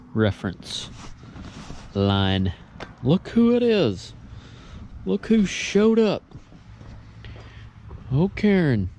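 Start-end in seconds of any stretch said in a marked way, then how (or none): 3.0-8.9 high sing-song voice for the cat; pet talk. Real, not acted. Talking to a cat, baby voice.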